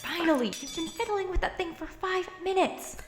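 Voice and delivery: high, impatient complaint; fed-up outburst; exasperated. Acted. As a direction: vent the long wait. Impatient with friend fixing radio.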